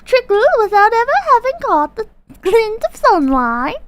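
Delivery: slow, grand British storyteller voice; posh British reading voice. Pretend. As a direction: read it like a posh British narrator. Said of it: "read in a posh British voice".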